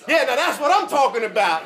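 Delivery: high, loud, laughing cheer; excited, loud, high-pitched praise; jubilant shout. Retold or imitated. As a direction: whoop it up as the band gets it right. Rodney cheering the band on.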